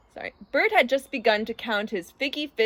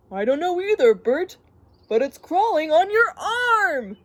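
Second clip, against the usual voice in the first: high, loud mock warning; alarmed prankster; 7 semitones higher, 5 dB louder than usual. Ernie warns Bert of something on his arm, mock-alarmed. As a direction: build to a high cry of alarm.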